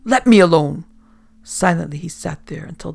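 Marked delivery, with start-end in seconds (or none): 0.1-0.9 much louder desperate scream. Acted. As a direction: scream it out, at breaking point. Captain screaming at crew.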